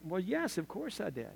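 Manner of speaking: small, hushed acted admission; sheepish father's answer. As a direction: admit it quietly to a child. Father answers his daughter's question.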